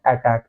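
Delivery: अटैक said "ae-tak", first vowel as in "cat"; textbook pronunciation. Shown as a demonstration. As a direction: open अटैक on ae, not uh. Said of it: "तो इसे हम क्या बोलते हैं?". (अटैक का उच्चारण 'ऐटैक')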